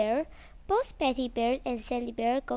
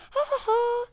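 laughing; 10 semitones higher, 4 dB louder, faster than usual. Acted. Bear laughs, not Christmas yet.

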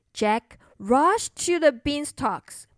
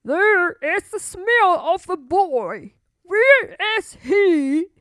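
louder, higher booming giant; a giant hunting for a boy, booming; 4 semitones higher, 6 dB louder than usual. Acted. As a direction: sniff the air like a hungry giant. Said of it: "He looked around and said".

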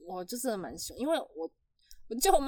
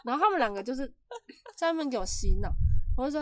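normal talking voice

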